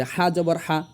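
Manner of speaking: "হা জবর হা" run together without gaps; letter, vowel name and sound in one breath. Shown as a demonstration. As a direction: say "হা জবর হা" as one joined phrase. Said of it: "যদি আপনি একসঙ্গে বলেন".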